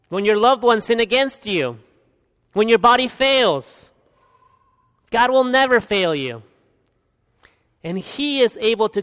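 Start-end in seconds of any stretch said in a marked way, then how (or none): none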